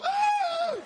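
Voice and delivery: high loud startled gasp; a shocked doctor yelps; doctor's gasp. Retold or imitated. Doctor's reaction to the burns.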